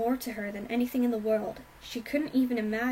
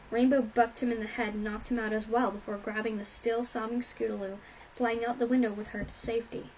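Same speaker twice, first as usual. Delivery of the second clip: ordinary delivery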